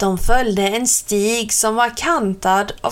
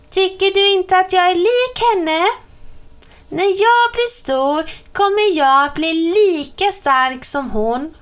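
put-on high little girl voice, loud; a small witch proudly comparing herself to mum; little witch boasting; 9 semitones higher, 3 dB louder than usual. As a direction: Celia boasting she will be strong. Celia bragging about her mother.

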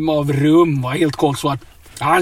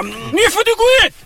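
shrill high shouted threat, much higher and louder; mock robber threat; 4 dB louder than usual. Driver staging a fake hijack to scare his passenger, high shouted threats. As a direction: play the masked gunman ordering him out. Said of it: "Det är alltså övning igen nu".

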